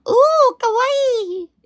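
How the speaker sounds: very high, slow squeal of kawaii; cute Japanese fan. Retold or imitated. Mimicking Japanese fans praising her nails, high squeal. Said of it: "Sinasabihan nila ako".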